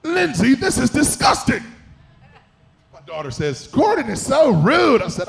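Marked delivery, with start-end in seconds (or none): 0.0-1.6 high-pitched mock outburst of disgust; disgusted chef. Chef tasting Lindsay's dish, hammed-up disgust. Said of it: "He gets the sour face". 3.7-5.0 higher, louder, drawn-out imitation of daughter; indignant daughter. Daughter reacting to chef's insult, mock indignation.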